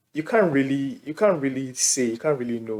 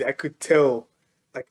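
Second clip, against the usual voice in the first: usual speaking voice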